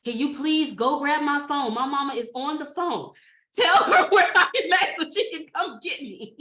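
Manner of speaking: desperate pleading. Retold or imitated. Begging officer to grab phone.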